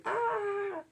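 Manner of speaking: high, held mock-panic "ah"; mock panicked wail. Anxious sleeper waking in the night, mock panicked wail. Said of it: "in the morning in a panic".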